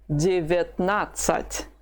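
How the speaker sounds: Девятнадцать said slowly, each syllable clear, reduced vowels as i; slow teaching demo. Shown as a demonstration. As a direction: spell out Девятнадцать slowly for a learner. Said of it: "Both the first and the second vowels, have been reduced".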